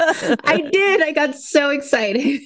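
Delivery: high-pitched, giggly confession; laughing admission. Real, not acted. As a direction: own up excitedly through laughter. Admits excitement amid shared laughter.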